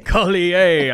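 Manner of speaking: loud, high French "kol-ee-AY"; mock French accent. Host joking about his own slip, hammed-up French accent. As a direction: ham up Collier as a French name. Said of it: "his French accent there".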